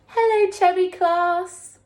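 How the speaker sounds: friendly alien voice. Acted. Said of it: "he's saying".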